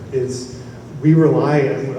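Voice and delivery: ordinary talk, nothing marked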